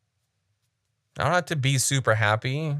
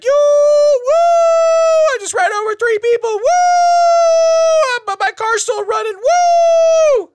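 much higher, loud hammed-up whooping; mock a euphoric worshipper, shrieking woo; mocking giddy churchgoer; 9 dB louder, slower than usual. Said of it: "You sit there and be like".

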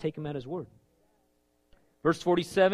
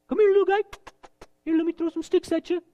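much higher, loud taunting voice; mocking giant; 13 semitones higher, 7 dB louder, faster than usual. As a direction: taunt the little shepherd boy. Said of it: "The Philistine starts mocking him".

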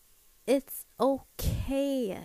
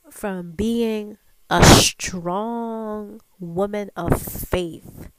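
loud, slow, emphatic vow; talking back; 7 dB louder, much slower than usual. Acted. Woman declaring her strength to her inner voice, slow and forceful. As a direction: draw out each word with force. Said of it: "talk back to my inner voice".

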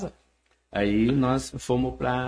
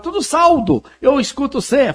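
excited fan; 12 semitones higher, 8 dB louder than usual. Retold or imitated. Fan gushing at the singer.

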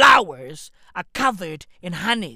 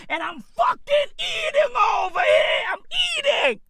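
outraged shout. Real, not acted. Peak of rant.

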